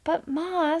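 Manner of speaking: put-on high pleading child voice; a little one begging Ma; another child. A narrator's reading. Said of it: "said another".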